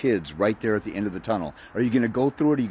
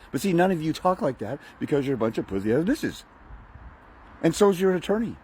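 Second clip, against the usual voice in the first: ordinary talk, nothing marked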